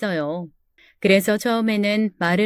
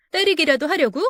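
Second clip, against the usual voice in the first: higher incredulous challenge; defiant challenge; 6 semitones higher than usual. Acted. Wife daring her menacing husband in the fight, defiant. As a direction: dare him, shocked and angry.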